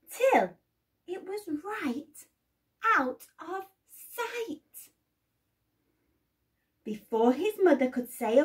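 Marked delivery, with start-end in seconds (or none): 0.1-4.6 up and up, out of sight; rising high. A narrator's reading.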